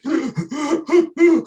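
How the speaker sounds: sharp high gasp, acted out; mock gasping for air. To a podcast host, showing his panic, a mock gasp. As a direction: gasp like you can't get air. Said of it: "I'm like".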